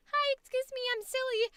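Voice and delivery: high, silly and cute. Retold or imitated. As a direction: chirp it out playfully. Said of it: "changed your energy from like".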